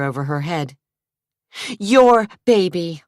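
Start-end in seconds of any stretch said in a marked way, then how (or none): 1.8-3.0 higher, louder, insisting; angry. A narrator's reading. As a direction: fling it at him in anger. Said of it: "she said angrily".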